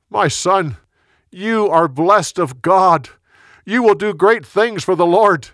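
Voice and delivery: high, loud proclamation; calling out a blessing. A narrator's reading. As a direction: proclaim the blessing across a distance. Saul calls a blessing to David.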